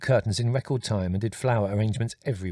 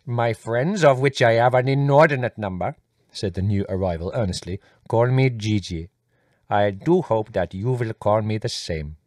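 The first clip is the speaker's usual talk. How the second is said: effusive Indian guest; 5 dB louder than usual. Acted. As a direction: flatter each family member in turn. Voices Gigi, earnest.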